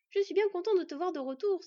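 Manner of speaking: happy welcome, high and loud; rejoicing. A narrator's reading. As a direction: greet a friend with open delight. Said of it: "se réjouit Bord". (accueil réjoui, voix haute et forte)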